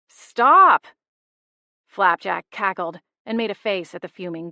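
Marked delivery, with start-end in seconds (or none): 0.1-0.8 much higher, much louder command; sharp shouted stop. A narrator's reading. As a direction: shout to halt them. Stopping a fight.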